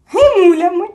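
high, loud cheeky snort of a word; small creature teasing the Hemulen; snorting retort. A narrator's reading. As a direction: snap back mockingly. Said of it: "чмихнув Чмих".

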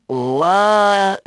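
high, loud shocked what. Shown as a demonstration. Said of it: "Or am I sound like this?".

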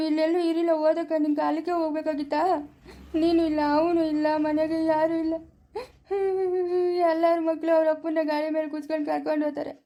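crying as they complain of loneliness; tearful lament. Acted. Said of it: "ಯಾಕಪ್ಪ ಅಳ್ತಾ ಇದೀಯಾ?". (ಅಳುತ್ತಾ ದುಃಖ ತೋಡಿಕೊಳ್ಳುವ ಧ್ವನಿ)

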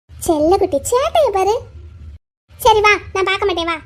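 usual speaking voice

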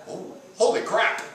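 sudden high startled voice. Real, not acted. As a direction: jump in surprise mid-sentence. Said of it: "That was a surprise".

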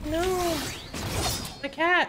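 squeaky high-pitched panic; high squeal. Real, not acted. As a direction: squeal it in shock. Sees his pet shot.

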